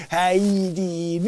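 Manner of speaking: slow, drawn-out put-on greeting; imitating the greeting. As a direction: echo his goofy hello slowly. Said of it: "And I'd just be like".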